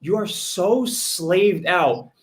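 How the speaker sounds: slow, deliberately nice delivery; said nicely. Shown as a demonstration. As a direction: spells it out slowly as if being polite. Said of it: "all right, I'll say it nicely".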